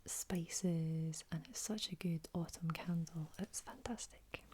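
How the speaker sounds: Scottish words ASMR whisper. Real, not acted. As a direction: whisper it gently like a bedtime secret.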